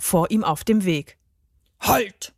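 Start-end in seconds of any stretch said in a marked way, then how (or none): 1.8-2.3 put-on commanding elf call; deep-voiced elf. A narrator's reading. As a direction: order a halt as a bearded elf. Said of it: "rief sie mit tiefer Stimme". (herrische Elfenstimme)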